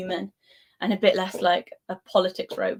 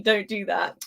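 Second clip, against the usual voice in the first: ordinary talk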